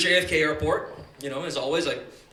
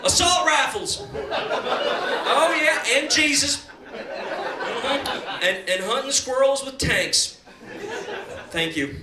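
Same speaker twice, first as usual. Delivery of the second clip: Republican candidate; 7 semitones higher, much slower than usual. Retold or imitated. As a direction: bark absurd answers with proud conviction. Candidate answering health care question.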